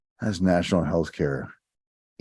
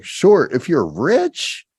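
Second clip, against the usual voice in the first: sarcastic; 9 semitones higher, 4 dB louder, much slower than usual. Retold or imitated. Sarcastic retort to libertarians.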